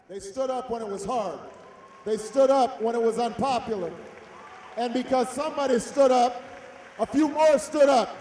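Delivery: building to a rousing shout; rising crowd-stirring oratory; rally speech. Real, not acted.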